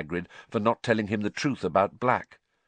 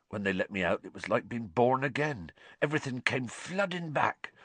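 Hagrid; 8 semitones higher than usual. A narrator's reading. Hagrid voiced in story.

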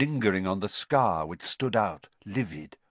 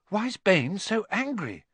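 put-on higher-pitched boy's voice; puzzled boy; 9 semitones higher than usual. A narrator's reading. Boy asking his rescuer about the quarrel, puzzled. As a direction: ask in a boy's raised, puzzled tone.

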